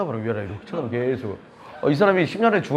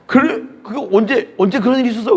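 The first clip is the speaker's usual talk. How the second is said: much higher, louder startled questions; astonished; 9 semitones higher, 6 dB louder than usual. Retold or imitated. Hearing from his wife about the night before, astonished. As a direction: sound caught completely off guard.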